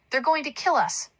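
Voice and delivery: narrated yell of alarm. A narrator's reading. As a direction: call out a warning to everyone. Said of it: "Pee-wee ran out the door yelling".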